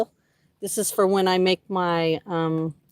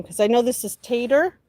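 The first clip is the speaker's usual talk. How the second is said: ordinary delivery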